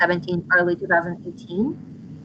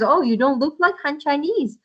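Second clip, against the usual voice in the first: higher-pitched surprised exclamation; mock-astonished remark, pitched up; imitating surprise; 7 semitones higher than usual. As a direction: voice someone taken aback, pitch lifted. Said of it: "they always, uh, surprised".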